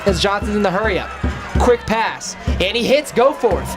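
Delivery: building from steady call to excited; rising play-by-play. Real, not acted. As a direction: speed up and climb as the play breaks. Quick pass, broken tackle.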